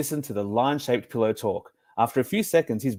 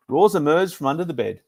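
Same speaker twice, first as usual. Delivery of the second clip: usual speaking voice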